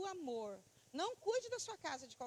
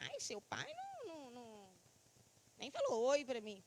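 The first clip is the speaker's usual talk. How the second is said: mock complaining; 4 semitones lower than usual. Voicing a parent complaining about spouse.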